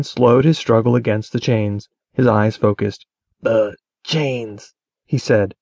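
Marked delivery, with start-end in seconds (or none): 3.4-4.7 halting words. A narrator's reading. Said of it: "his words halting".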